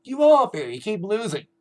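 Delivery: retold loud sneering taunt; mock taunting. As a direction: jeer it like a heel cutting a promo. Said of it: "saying that Big Show said".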